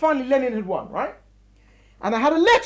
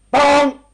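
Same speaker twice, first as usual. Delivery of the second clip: a loud, blunt sung bong; rigid thunder bong; 9 dB louder than usual. Shown as a demonstration. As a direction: boom it out mechanically like a metronome. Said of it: "demonstrating the rigid thunder".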